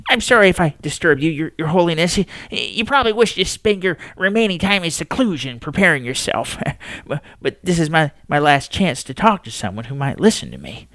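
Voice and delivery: apologetic, pleading to be heard; nervous old man. Acted. Astronomer begs the Pope for a listening ear, nervous.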